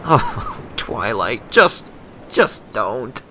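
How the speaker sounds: half-laughing, pained plea to stop the pun; mock-exasperated Rainbow; exasperated groan. A narrator's reading. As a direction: groan at a bad joke, then beg her to quit. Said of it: "face-hoofed and groaned loudly".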